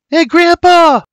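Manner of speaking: high, loud protesting cry; full-voice girl's shout. Acted. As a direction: yell it as you resist being pulled away. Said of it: "Lizzie shouts".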